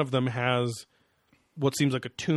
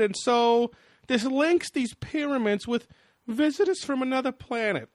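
scared nerd voice; 13 semitones higher, 5 dB louder than usual. Retold or imitated. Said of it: "this is the scared sounding guy".

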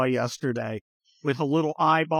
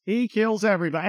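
ordinary delivery

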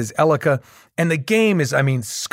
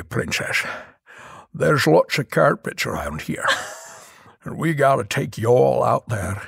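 Georgia Sean Connery. Pretend. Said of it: "do a Georgia Sean Connery as Prince of Persia".

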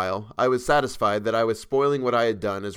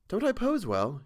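put-on higher young woman's voice, insisting; 10 semitones higher than usual. A narrator's reading. Model insists to the painter, higher pleading voice. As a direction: push back, a little hurt. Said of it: "she insisted".

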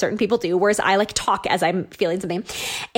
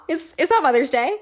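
mildly baffled, higher-pitched imitation; puzzled reply; 6 semitones higher than usual. Mother-in-law correcting a mistaken greeting, mildly puzzled. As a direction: hesitantly question it, pitch lifting.

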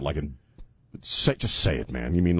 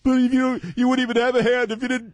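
high bickering voice; 12 semitones higher than usual. Acted. Acting the bickering couple.

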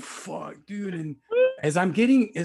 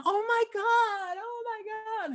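put-on girlfriend shrieking. Girlfriend after lamp hits her lover, mock shocked squeal. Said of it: "And then she went".

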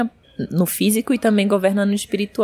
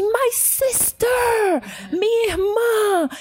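high, slow, overjoyed cry; joyful cry; 14 semitones higher, slower than usual. Retold or imitated. Armed officer greeting his new sister, high slow joyful cry. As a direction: embrace her with a joyful cry.

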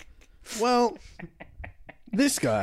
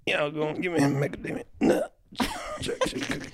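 hushed beggar voice, fading to a whisper; mock street-corner plea, very soft; guy begging outside Subway; 6 dB quieter than usual. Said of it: "You sounded like a guy out front of Subway".

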